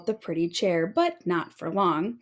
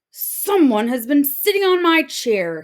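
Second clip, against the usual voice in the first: father octopus announcing; 5 semitones higher, 6 dB louder than usual. Acted. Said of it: "he announced".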